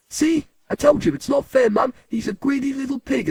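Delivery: put-on high, indignant child's accusation; outraged brother. Brother accusing the raisin-eater to Mum, outraged. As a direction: tell tales in a high, aggrieved voice. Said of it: "And my brother says".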